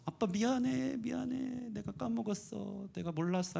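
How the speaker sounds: put-on mild crying child. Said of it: "애가 먼저 울면서".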